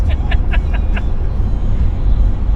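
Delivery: a high, airy burst of laughter; high breathy laugh. Real, not acted. Laughs at having no friends.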